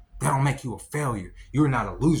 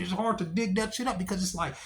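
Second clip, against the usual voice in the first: normal talking voice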